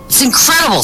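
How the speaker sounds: high-pitched acted amazement; amazed. Bartender marvels at a phone in a hand, amazed. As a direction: exclaim it like an astonished bartender.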